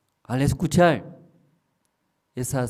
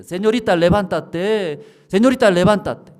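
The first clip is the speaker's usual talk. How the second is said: insistent calling voice; 9 semitones higher, 9 dB louder than usual. Retold or imitated. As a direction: keep calling her to get up. Voice calling again, drawn out.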